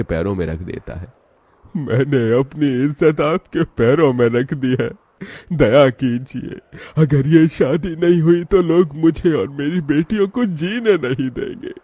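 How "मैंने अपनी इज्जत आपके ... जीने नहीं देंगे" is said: higher and louder, a mild desperate plea; begging father. Acted. Shankar begs Rama at her feet, desperate pleading. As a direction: beg for mercy with honour laid down.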